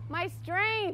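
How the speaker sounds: usual speaking voice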